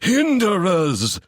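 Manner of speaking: slow, rising, wild voice; raving Ewan. Acted. Ewan raving at Seb from the ground, slow and wild. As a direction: build into a wild cry.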